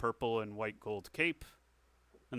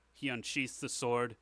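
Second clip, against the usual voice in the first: ordinary talk, nothing marked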